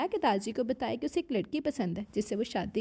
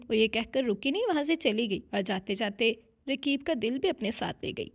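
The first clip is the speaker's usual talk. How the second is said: usual speaking voice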